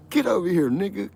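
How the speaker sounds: high, loud barking guard; mock guard yell. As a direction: bellow like a prison guard. Guard yelling at inmate.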